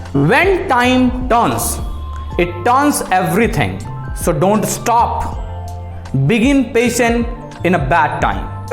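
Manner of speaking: slow, weighty motivational delivery with pauses; motivational intonation. Shown as a demonstration. Coach showing a student how to say the quote, slow and expressive. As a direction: rise and fall with feeling, phrase by phrase. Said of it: "अब इसको बोलने का मैं तरीका बताता हूँ".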